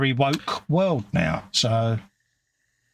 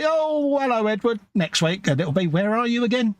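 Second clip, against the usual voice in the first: higher, overly bright mock hello; mock cheerful greeting; 9 semitones higher than usual. Co-host pictures them greeting Edward, mock cheerful and higher. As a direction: ham up a clueless host greeting a viewer. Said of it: "we will say".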